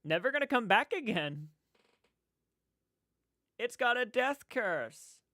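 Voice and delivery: loud, high-pitched prophet of doom; Crazy Ralph. Acted. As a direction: warn them of the curse like a crazy old man. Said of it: "I would be Crazy Ralph".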